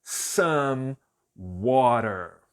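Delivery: slow, careful English "some water"; English demo. Shown as a demonstration. Teacher modelling "some water" slowly for Brazilian learners. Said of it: "eu vou dizer some water".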